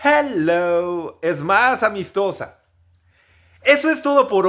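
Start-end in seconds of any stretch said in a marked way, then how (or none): 0.0-1.2 hello stretched long and friendly. Shown as a demonstration. Teacher showing the longer, friendlier hello. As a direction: draw out hello to sound friendly. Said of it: "esa larga pronunciación de".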